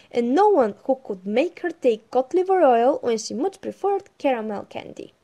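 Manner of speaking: loud, lively storytelling voice; story narration. Acted. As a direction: read it out like a bedtime storybook. Reads the Pippi story aloud.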